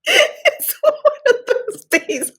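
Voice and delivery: slow, high, giggling through the words; laughing apology, barely composed. Real, not acted. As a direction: laugh it out slowly.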